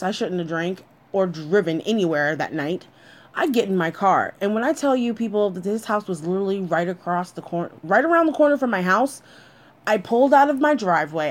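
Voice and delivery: plain reading voice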